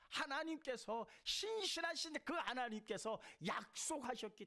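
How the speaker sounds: high, loud, ringing sermon cry; full-force preaching. Acted. Pastor to the congregation, raising the voice in fervent preaching. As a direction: preach it out to the whole hall.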